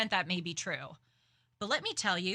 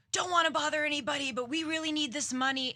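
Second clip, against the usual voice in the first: higher, fast mock-plea with a touch of a yell; mock yelling beggar; 7 semitones higher, faster than usual. A man keeps calling out to riders for money, high fast mock plea. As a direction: rattle off the plea like a man calling out to the whole car. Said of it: "as the guy continues to yell".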